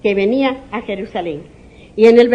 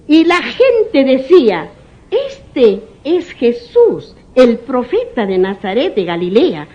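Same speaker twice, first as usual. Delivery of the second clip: scripture reading; 4 dB louder, slower than usual. Acted. Preacher reading scripture aloud.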